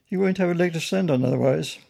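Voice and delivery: firm caution, lifted in pitch; official warning. Acted. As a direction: warn with firm authority. Policeman warning the bear.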